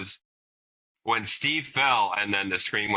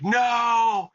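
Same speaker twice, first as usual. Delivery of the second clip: imitated high, loud, drawn-out "no" of panic; freaking out; 12 semitones higher, 4 dB louder than usual. Parent imitates daughter's reaction to the TV, freaking out. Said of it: "she started freaking out".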